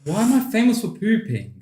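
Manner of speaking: incredulous. Real, not acted. Lightheaded from hot sauce.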